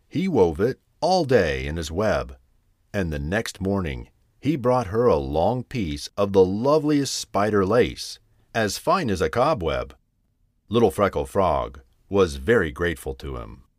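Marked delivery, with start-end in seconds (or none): none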